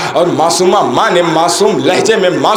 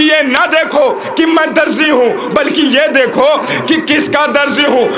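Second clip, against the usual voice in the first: higher, shouted retelling of Rizwan's words; preacher's shout; 6 semitones higher than usual. Acted. Preacher voicing Rizwan to the congregation, shouting. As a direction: cry it out to the congregation.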